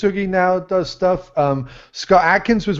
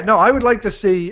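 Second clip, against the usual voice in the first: ordinary talk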